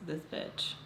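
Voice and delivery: low-key, hushed aside to oneself; softly seething under the breath; muttered inner thought. Retold or imitated. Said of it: "my brain goes".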